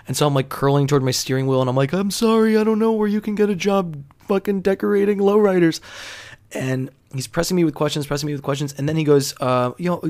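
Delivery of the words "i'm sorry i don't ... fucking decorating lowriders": high, strained-small apologetic voice; imitating your own uneasy younger self, pitched way up; uncomfortable apology. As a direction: squirm through an awkward, high-pitched apology. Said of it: "just uncomfortable in my skin".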